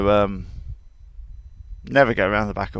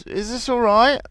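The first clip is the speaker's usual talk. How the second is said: high, questioning horse voice; a horse checking with its rider; lightly voiced horse asking if it's all right; 10 semitones higher than usual. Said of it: "Jupiter goes".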